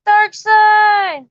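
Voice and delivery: high, loud call of a name; joyful shout across the room; excited shout-out. Real, not acted. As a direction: hail him as he arrives. Calls out to an arriving guest.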